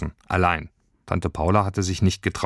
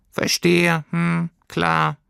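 high, small wounded voice; hurt squeaky creature; 10 semitones higher, much slower than usual. A narrator's reading. Rejected creature tells girl it understands, hurt. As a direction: squeak it out, trying to accept it.

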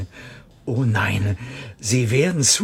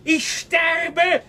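high, frantic rant rising to a cracked wail; hysterical outburst. Acted. Clerk raving at the director he's dying, frantic and high. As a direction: unravel into a desperate high cry, stretching out sterbe.

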